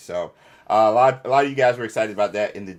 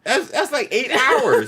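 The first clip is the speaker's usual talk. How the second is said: amazed; 12 semitones higher, 4 dB louder, slower than usual. Real, not acted. Amazed at listening speed.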